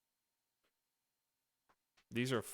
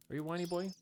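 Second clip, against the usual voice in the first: put-on baby talk; 7 semitones higher, much faster than usual. Talking to the dog.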